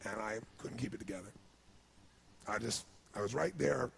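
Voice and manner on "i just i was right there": ordinary talk, nothing marked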